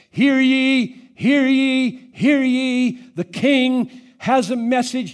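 loud, high herald's proclamation; town crier. Acted. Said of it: "a town crier".

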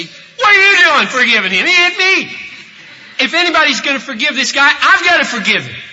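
loud, high-pitched outraged protest; incredulous, yelling objection; indignant victim. Retold or imitated. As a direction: shout it as the wronged man. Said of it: "Tom would say".

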